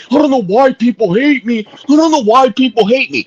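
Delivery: high-pitched mocking self-pity; mocking, high "poor me" complaint; mock pity party. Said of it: "throwing a fucking big old pity party".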